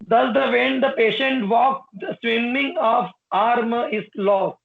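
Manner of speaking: very slow, spaced reading; slow dictation. Shown as a demonstration. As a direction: dictate word by word. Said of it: "dictating notes".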